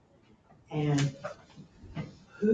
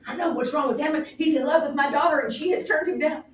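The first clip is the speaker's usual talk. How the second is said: high, loud and fast, excited busybody; a fussy old courtier bursting with his theory; Polonius; 11 semitones higher, 10 dB louder, faster than usual. Acted. Polonius telling the king his theory, high and hurried.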